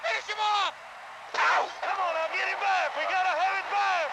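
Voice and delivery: high, loud stadium cheering; cheering shout. Real, not acted. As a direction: urge the team on at full voice. Fan cheering team on.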